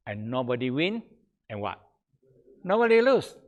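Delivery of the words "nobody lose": excited punchline. Real, not acted.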